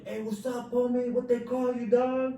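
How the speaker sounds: high, loud yelling across cells; yelling inmate. Retold or imitated. Inmate yelling at new arrival, loud and high. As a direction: call out like a con from a cell.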